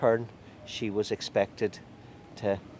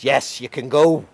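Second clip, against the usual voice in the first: loud, raised, grudging release; miffed; 5 semitones higher, 9 dB louder than usual. A narrator's reading. Thwarted father releases the young man, miffed. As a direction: let him go with irritated force. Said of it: "he was a bit miffed".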